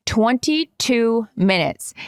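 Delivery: usual speaking voice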